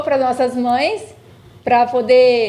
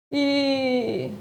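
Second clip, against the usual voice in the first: tearful; 4 dB quieter than usual. Real, not acted. Daughter tearful Mother's Day message.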